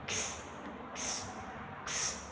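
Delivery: X as an unvoiced ks; whispered ks sound. Shown as a demonstration. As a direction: breathe the ks sound out. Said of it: "We pronounce it".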